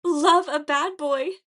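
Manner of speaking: high, loud, drawn-out squeal; swooning high-pitched squeal; convincing squeal. A narrator's reading. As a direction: squeal it like an adoring fan. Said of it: "with a convincing squeal".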